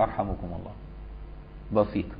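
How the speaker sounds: soft, hushed blessing to a sneezer; gently, softly. Shown as a demonstration. Worshipper blesses a sneezing neighbour during the sermon, softly. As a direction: say it quietly under your breath. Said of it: "بالراحة كده تقول له".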